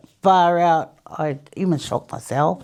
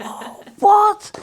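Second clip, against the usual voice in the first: much higher and louder, shocked; stunned disbelief; 6 dB louder than usual. Retold or imitated. Reacting in shock that the prizes are hers, much higher. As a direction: gasp it out in disbelief.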